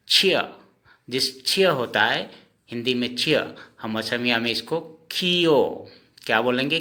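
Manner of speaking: ordinary talk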